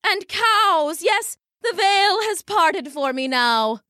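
grand soothsayer, pleased with herself; fortune teller voice. Acted. Madame Madone's mystic voice.

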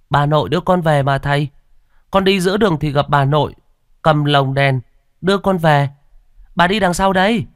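put-on high-pitched child explaining; childlike, guileless report to parents; innocent boy. A narrator's reading. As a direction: tell it brightly as a little boy.

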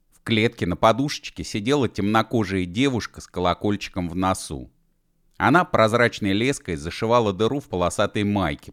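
ordinary delivery

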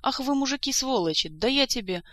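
indignant cursing, starts high; acted angry outburst; furious. A narrator's reading. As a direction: hurl insults and a threat. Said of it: "разъярилась Ольга".

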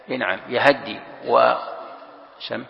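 normal talking voice

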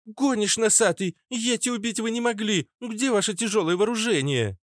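put-on high girlish disbelief; sceptical feisty girl. Girl calls Kats a liar, high and scornful. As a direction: challenge him in a squeaky voice.

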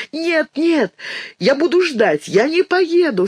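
put-on high little-girl voice, refusing through tears; tearful protest. A narrator's reading. Dinka refuses her mother's plea, high tearful cry. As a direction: wail the refusal, without much volume. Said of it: "со слезами кричала Динка".

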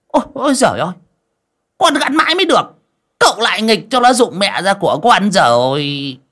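very high-pitched wailing complaint; dismayed outcry. A narrator's reading. Coffin maker seeing his fresh carving knocked off, wailing in dismay. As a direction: wail in shock, drawing out the end.

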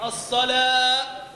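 الصلاة ending with the final ha swallowed, slow and loud; dropped ha. Shown as a demonstration. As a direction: lose the ha of الصلاة entirely. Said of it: "ضع، جميل".

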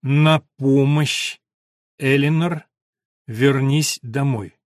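very slow, word by word; slow reading. A narrator's reading. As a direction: read it out haltingly. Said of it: "прочёл медленно".

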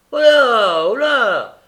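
loud, high call from far off; distant trembling cry. A narrator's reading. A frightened pursuer calls from afar, loud distant cry. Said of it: "cria dans le lointain une voix tremblante".

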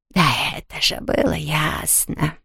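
soft hollow ghost voice. A narrator's reading. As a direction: shrug it off as the ghost. (голос привидения)

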